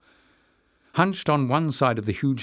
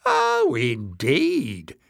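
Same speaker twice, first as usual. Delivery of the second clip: high swooping mock amazement; jeering; 4 semitones higher, much slower than usual. A narrator's reading. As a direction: jeer it, starting very high. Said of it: "jeered Jinx".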